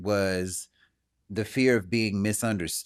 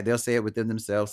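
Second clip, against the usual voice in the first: ordinary delivery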